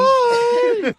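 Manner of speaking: high goofy puppet voice; squeaky little puppet saying hello; goofy muffled sock puppet. Acted. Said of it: "same puppet voice constantly".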